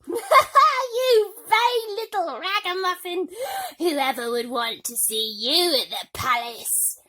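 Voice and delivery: high, loud, slow insult; jeering stepsister. A narrator's reading. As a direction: spit it at her with scorn.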